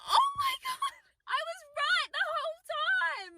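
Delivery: very high-pitched drawn-out wail; vindicated shriek. Retold or imitated. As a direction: shriek it slowly in mock-hysterical vindication. Outraged vindication, retold reaction.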